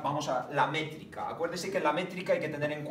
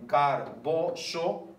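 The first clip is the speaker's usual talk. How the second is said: very slow, syllable by syllable; teacher scanning a verse aloud; slow scansion reading; 3 dB louder, much slower than usual. Shown as a demonstration. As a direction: drag out "garboso" to count it. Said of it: "uno, dos".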